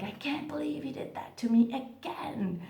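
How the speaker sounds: hushed whispered complaint; breathy whispered mock grievance. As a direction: whisper it like a secret grudge. Tempted to vent, whispered aside.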